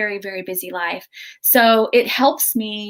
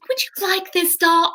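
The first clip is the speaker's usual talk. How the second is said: put-on kind old lady; 9 semitones higher than usual. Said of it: "she goes".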